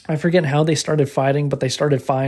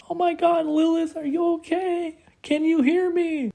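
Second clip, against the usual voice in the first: Jamie Lee Curtis yelling; 14 semitones higher than usual. Retold or imitated. Imitating Jamie Lee Curtis calling to fallen Lilith, high mock panic. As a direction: squeal out a mock-worried call, way up high. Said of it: "Jamie Lee Curtis starts yelling".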